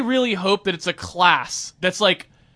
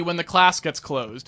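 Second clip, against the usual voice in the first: normal talking voice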